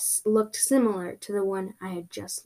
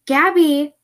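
louder, higher call for someone missing; yelled call; 5 semitones higher, 8 dB louder than usual. Acted. Calling again for a missing friend, yelled. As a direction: yell out the name. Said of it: "I yelled".

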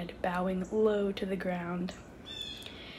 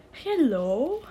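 put-on loud, swooping French-accented greeting; outrageous French accent; 5 semitones higher, 7 dB louder than usual. A narrator's reading. The bow greeting two kids, outrageous French accent. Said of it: "outrageous and completely unnecessary French accent".

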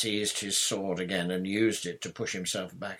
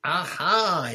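high, loud cry of discovery; yell; 9 semitones higher, 9 dB louder than usual. A narrator's reading. Knight spots intruders on his lands, yelling. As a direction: pounce on it with a triumphant yell. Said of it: "he yelled".

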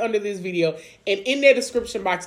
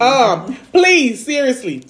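ordinary talk

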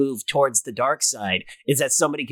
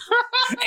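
high burst of laughter. Real, not acted. Laugh sound.

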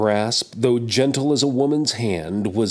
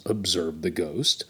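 usual speaking voice

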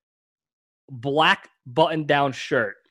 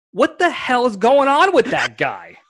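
higher, slow mock coach outrage; drawn-out bewildered coach voice; baffled coach; 6 semitones higher, slower than usual. Coach quoted in story.

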